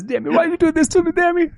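very high, fast pleading mock voice of the mother; demon as the mother. Demon mimicking the mother to taunt Damien, very high and pleading. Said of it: "and then she says".

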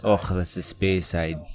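slow mock Scottish with throaty "och"; put-on Scots; Scottish accent. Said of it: "That sounds a bit more Scottish".

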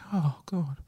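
whispered. Real, not acted. Moved by sad story.

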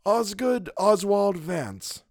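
high, slow, deliberate reading of the name; reading aloud. A narrator's reading. Wilde reading the ledger entry, high and slow. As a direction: savour each name slowly. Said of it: "he read aloud".

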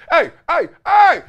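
high, loud hey hey hey; the coach hollering his name, hammed up; mock coach yell. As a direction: yell after him to stop him. Coach shouting at the player.

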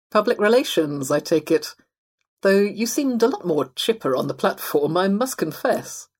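genteel fox, pitch lifted; upper-middle-class fox. Acted. Fox teasing the possum about his new job, genteel. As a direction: voice a well-to-do fox making conversation.

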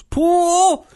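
put-on high, loud, gleeful kid shout of pool; a toddler shrieking with delight; little boy's excited yell. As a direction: squeal it like a thrilled little kid. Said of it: "and he goes".

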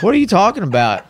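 high-pitched mock bewilderment; baffled reply. Retells his baffled reply to texts.

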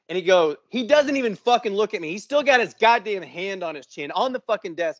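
ordinary talk

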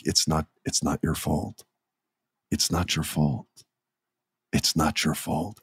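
low, soft, gentle reassurance; quiet, tender movie-therapist impression; sweet and tender. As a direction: say it small and softly, as if consoling. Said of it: "he plays it so sweet".

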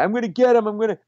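high-pitched mock ranting; imitated screamed threat; screaming threats. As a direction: rant like a wounded man after revenge. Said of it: "screaming about this guy".

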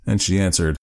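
usual speaking voice

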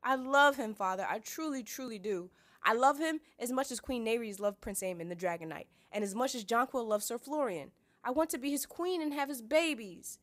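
high, loud, gushing plea; Sansa. A narrator's reading. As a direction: lovestruck girl pleading with her father. Sansa gushes about her love.

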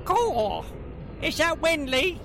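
high little gnome voice; squeaky small gnome calling out; pixie. Acted. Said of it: "you hear a little voice call out".

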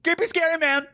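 high-pitched panicked cry, imitated; hammed-up freak-out, pitch shot way up; flipping out. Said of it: "he flips out".

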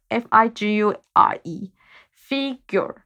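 F I G U R E letter by letter, then the word "figure"; spelling out FIGURE. Shown as a demonstration. Student practising a word she finds hard, spelled out slowly. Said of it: "这是我最近在一起念的".

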